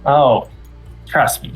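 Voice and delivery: usual speaking voice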